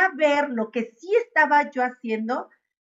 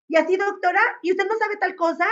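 higher, fast teacher's reprimand; mock scolding professor; 8 semitones higher, much faster than usual. Retelling a professor scolding a student in front of a patient, higher mock reprimand. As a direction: imitate a professor shaming a student.

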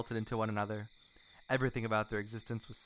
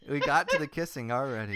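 loud, higher, amused outburst; real laughter running through the words; laughing; 5 semitones higher, 6 dB louder than usual. Real, not acted. Reader breaks off at the kissing scene, laughing loudly.

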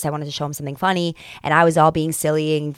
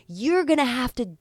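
higher, mock-preachy lecture; little lecture; 7 semitones higher than usual. Lecturing her boyfriend about working for love, mock preachy. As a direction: lecture him with playful sass. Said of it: "I gave him a little spiel".

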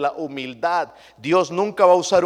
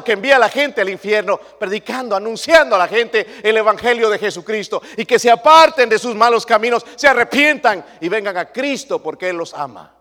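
raised, forceful preacher voice; preaching; 6 semitones higher, 9 dB louder than usual. Acted. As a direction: call the crowd to repent, full voice.

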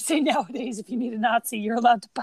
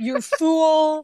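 louder, drawn-out mock villain scorn; 3 semitones higher, 5 dB louder than usual. Podcaster jeering at the film's master criminal, mock scorn. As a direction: draw it out in gloating mockery.

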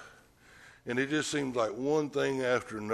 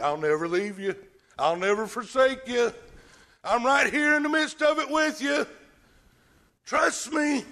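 building higher and louder to a cry; rising fervent promise; 10 semitones higher, 4 dB louder than usual. Acted. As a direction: swell into a passionate vow.

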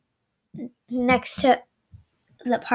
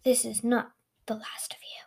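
whispered threat; 9 dB quieter, faster than usual. Acted. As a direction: whisper it like a defeated villain. Villain-style parting threat.

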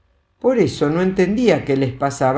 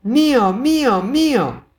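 put-on angry little girl; 10 semitones higher than usual. A narrator's reading. Little girl shaking the toad, angry high cries. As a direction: shake it out like a furious toddler. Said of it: "lo sacudía enojada".

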